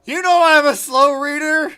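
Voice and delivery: loud, high, drawn-out mock protest; mock outraged yell. Streamer protesting that she can't keep up reading, mock outraged yell.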